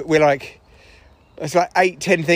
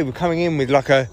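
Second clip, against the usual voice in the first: normal talking voice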